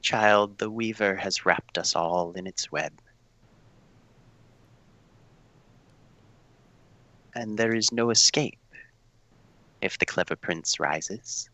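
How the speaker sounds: softly, low and ominous; a cryptic seer murmuring a warning; low ominous prophet voice. Acted.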